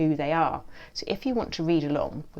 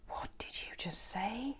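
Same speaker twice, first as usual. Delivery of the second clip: soft, slow, hissing whisper; hissed whisper; 6 semitones higher, 9 dB quieter, slower than usual. A narrator's reading. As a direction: hiss the question slowly. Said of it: "hisses the snail".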